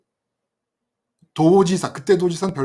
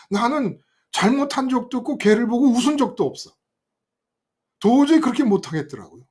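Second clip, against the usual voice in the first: ordinary talk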